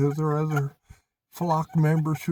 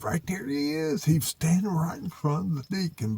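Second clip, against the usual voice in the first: ordinary delivery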